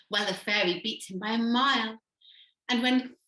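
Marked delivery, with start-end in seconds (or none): none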